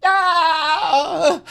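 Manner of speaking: very high, squeaky cartoon voice; SpongeBob impression. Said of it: "Here's SpongeBob".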